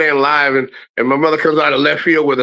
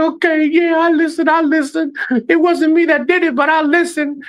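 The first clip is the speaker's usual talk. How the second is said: put-on high, pleading child voice, much higher; pleading kid. Scared son pleading with his father, high and pleading. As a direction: beg like a scared kid with an ear twisted. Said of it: "I was like".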